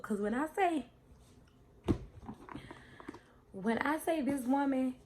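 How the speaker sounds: loud, high, excited praise. Real, not acted.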